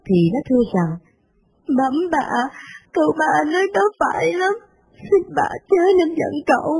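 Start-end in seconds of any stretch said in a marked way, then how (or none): 1.8-6.7 tearful plea. Acted. Maid begging her mistress to forgive the son, tearfully. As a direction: plead gently through tears. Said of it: "thì nó khóc mướt".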